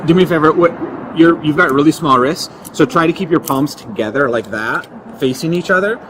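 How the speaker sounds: usual speaking voice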